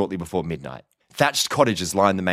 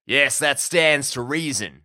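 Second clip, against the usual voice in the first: slow, higher-pitched mocking drawl; sardonic American cowboy; 6 semitones higher, much slower than usual. A narrator's reading. Prisoner humours his visitor, sardonic. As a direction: draw it out with mock approval.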